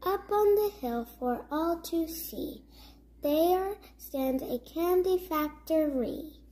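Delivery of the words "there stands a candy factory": ordinary delivery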